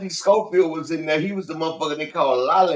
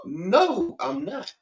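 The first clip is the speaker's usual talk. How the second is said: mock denial; 8 semitones higher, much slower than usual. Acting out movie character's reply.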